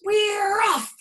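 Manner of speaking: high, loud cheer; group cheer; all shouting. A narrator's reading. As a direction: cheer as the ship sets sail. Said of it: "we all shouted".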